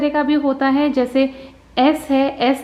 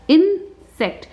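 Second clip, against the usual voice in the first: "Insect" higher, split as in, sect; broken into syllables; 4 semitones higher, much slower than usual. Shown as a demonstration. Teacher repeating the two syllables, "insect" said in parts. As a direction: pause between इन and sect in "insect". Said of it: "इसके syllables हैं दो".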